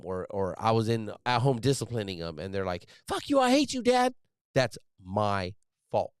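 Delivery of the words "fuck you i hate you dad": much higher mock teen outburst at dad; imagined angry kid. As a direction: voice the future kid lashing out, pitched way up.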